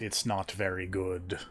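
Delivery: ordinary talk, nothing marked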